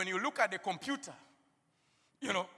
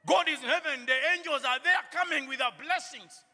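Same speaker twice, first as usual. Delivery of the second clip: loud, higher preaching climax; fervent preacher in full cry; preaching at full force; 5 semitones higher, 6 dB louder than usual. Acted. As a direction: preach it out, rising with fervour.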